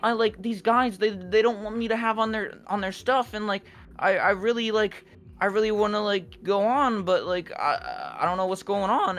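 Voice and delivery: high mocking whine, halting and full of hesitation; whiny complainer. Mimicking a rival complaining on Reddit, mocking high whine. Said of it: "complaining on Reddit".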